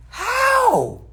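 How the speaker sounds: high, loud disbelieving "How?"; a sharp, high, stunned question; incredulous how. Retold or imitated. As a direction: shoot it out in disbelief. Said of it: "I used to be like".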